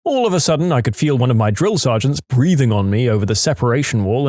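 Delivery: usual speaking voice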